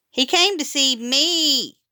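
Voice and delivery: high, slow, proud kindergartner; proud little brother. A narrator's reading. Steve tells the principal proudly about his dog, high and slow. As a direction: beam with pride like a small boy. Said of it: "Steve said proudly".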